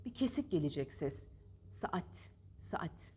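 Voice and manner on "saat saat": saat whispered with a slight break. Shown as a demonstration. Teacher quietly demonstrating the break in saat. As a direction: breathe saat with a cut in the middle. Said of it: "Hafif bir kesiklik".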